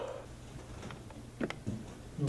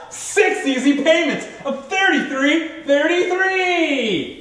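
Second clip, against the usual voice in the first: home shopping host; 9 semitones higher, much slower than usual. Acted. Infomercial price read.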